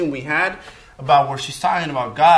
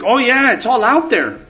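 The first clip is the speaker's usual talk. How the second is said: higher, louder, emphatic assurance; eager insistence; 7 semitones higher, 7 dB louder than usual. Retold or imitated. Woman assuring him the wild theory is out there, eagerly insistent. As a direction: play her enthusiastically insisting, pitched up and loud.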